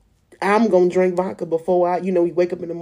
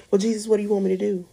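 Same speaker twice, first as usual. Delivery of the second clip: rushed plea; 3 semitones higher, much faster than usual. Retold or imitated. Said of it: "pleading question to God".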